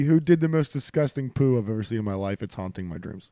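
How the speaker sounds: ordinary talk, nothing marked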